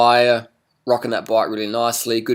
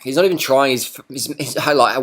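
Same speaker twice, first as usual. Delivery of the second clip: normal talking voice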